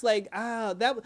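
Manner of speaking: normal talking voice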